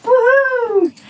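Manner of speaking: high, loud cheering whoop; a loud joyful yell of delight; excited whoop. Real, not acted. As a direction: whoop with real glee. Excited about picking up sax.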